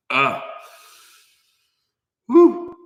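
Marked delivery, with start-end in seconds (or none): 2.3-2.7 high, loud whoop after a sigh; hot-jerky whoop; woo from the heat. Real, not acted. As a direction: blow off the burn with a whoop. Burning mouth from hot jerky.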